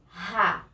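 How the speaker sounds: "Ra" said like "ha", isolated; lone syllable "Ra" with a throaty strong R. Shown as a demonstration. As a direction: breathe the R of "Ra" as an h. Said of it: "Pronúncia forte".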